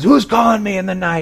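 high, irritated call back; frustrated. Acted. Commander woken by a far voice, frustrated high call. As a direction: demand it like someone woken at night. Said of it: "then he's frustrated".

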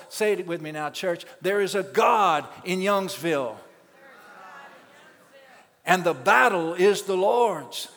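loud, fervent call to repeat; rally the church to shout it back; preaching at full force. Real, not acted.

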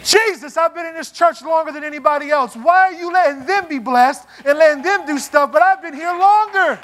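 mock-petulant complaint to Jesus; whiny complaint. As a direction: complain like a jealous longtime member. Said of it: "complaining churchgoer to Jesus".

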